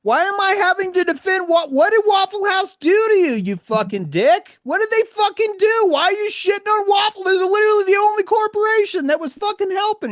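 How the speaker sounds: high, loud, exasperated yelling; furious rant. Real, not acted. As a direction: rant with real outrage, voice cracking high. Furious rant defending Waffle House.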